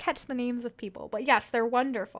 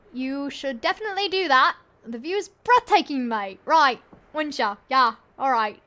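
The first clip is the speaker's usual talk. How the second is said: lightly voiced British phone clerk; a hint of a British carpet cleaner; British accent; 3 semitones higher, 8 dB louder than usual. Clerk brushing off the caller, light British accent. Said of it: "that British voice is spot on".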